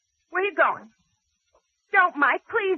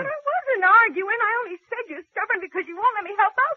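higher and louder, on the edge of tears; tearful protest; 7 semitones higher, 6 dB louder than usual. Acted. Wife defending herself to her husband, tearful and hurt. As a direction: plead your case while crying.

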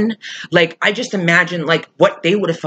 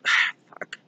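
exasperated whisper; 7 dB quieter than usual. Real, not acted. Frustrated sigh while ranting.

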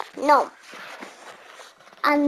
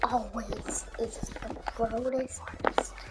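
very soft, low near-whisper; hushed aside; 7 semitones lower, 16 dB quieter than usual. Real, not acted. Player grumbling about another person, hushed aside. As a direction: whisper it under your breath. Muttered insult, very quiet.

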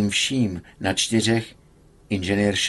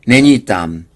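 sharp denial; 9 dB louder than usual. A narrator's reading. Patient searching the stolen book.

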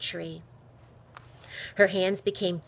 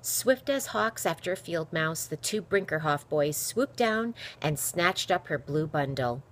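ordinary delivery

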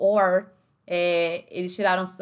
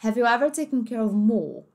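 British English, R dropped in ever and more; British accent. Shown as a demonstration. Teacher reproducing the British sound, British accent. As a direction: let more end in an open vowel, British-style. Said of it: "no inglês britânico".

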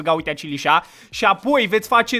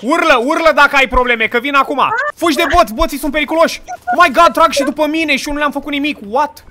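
louder and higher, urgent shouting; excited game alarm; 5 semitones higher, 5 dB louder than usual. Real, not acted. Gamer shouts to teammates while bots attack. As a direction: rush in to help, calling out.

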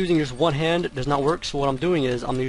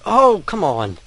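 loud, higher protest at failing; exasperated; 5 semitones higher, 7 dB louder than usual. Real, not acted. Gamer failing the button sequence, exasperated. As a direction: complain loudly at the game. Repeated failure in game.

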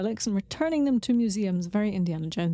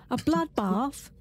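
slow, questioning mock Cockney; Cockney accent; 3 semitones higher, slower than usual. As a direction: echo it in a put-on Cockney accent. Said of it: "Whenever you try and do Cockney".

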